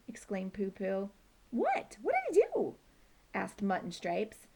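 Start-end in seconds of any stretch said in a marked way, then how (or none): 1.5-2.7 much higher startled cry; alarmed question. Acted. Mutt and Stripes asking Poo Poo, startled. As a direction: gasp out the question.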